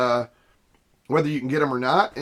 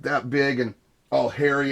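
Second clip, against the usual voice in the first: usual speaking voice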